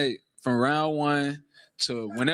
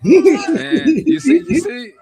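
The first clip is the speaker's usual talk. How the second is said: high laughing taunt through the words; 12 semitones higher, 12 dB louder, much slower than usual. Real, not acted. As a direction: laugh it out at the rival camp. Laughing at the rival camp.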